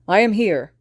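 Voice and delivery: loud heroic call; I am here, shouted out. Acted. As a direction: burst in announcing himself. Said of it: "he shouted".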